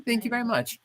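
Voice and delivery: high, chirpy casual announcement; mock breezy email voice. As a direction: read the news like it's nothing. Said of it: "they sent me an email like".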